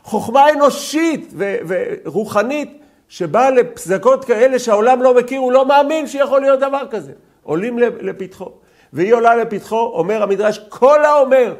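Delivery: high, loud preaching; fiery preaching. Acted. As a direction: preach it out to the class with full force. Teacher preaching at full force. (דרשה בלהט, גבוה וחזק)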